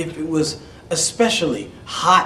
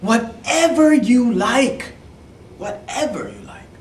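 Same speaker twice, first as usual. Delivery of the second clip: eager princess; 9 semitones higher, 4 dB louder than usual. Acted. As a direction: promise it sweetly. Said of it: "princess said".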